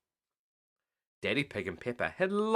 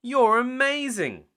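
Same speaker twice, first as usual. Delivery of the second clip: high, loud, slow praise; excited child; 12 semitones higher, 7 dB louder, slower than usual. Acted. Peppa praising Mummy, high and excited. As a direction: gush over Mummy.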